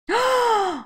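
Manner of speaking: high, sudden gasp; surprised gasp. Real, not acted. Seeing a new BMI score, surprised gasp. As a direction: gasp in delighted shock.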